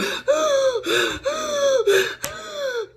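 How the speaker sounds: high, strained gasp; desperate gasp for air. Shown as a demonstration. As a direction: gasp as if choking. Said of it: "this is how I was".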